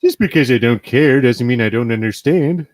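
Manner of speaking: loud cartoon dad impression; Homer Simpson. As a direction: put on a dopey animated father's voice. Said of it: "I see Homer saying that".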